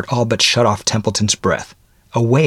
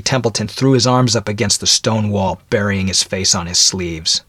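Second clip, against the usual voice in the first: plain reading voice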